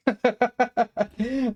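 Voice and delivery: high, bright burst of giggling; high giggle. Real, not acted. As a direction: laugh at your own silly idea. Laughs at own joke.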